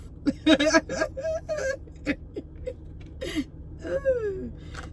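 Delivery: high laugh breaking into sobs; real laugh-sob, high and soft; laughing cry. Real, not acted. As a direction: laugh that cracks into crying. Laugh turning into crying.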